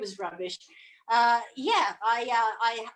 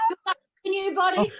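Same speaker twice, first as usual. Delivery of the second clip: higher, quick mock farewell; silly high voice; 8 semitones higher, 3 dB louder, faster than usual. Bidding the possessed host farewell, higher and quick. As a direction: play along with the alien.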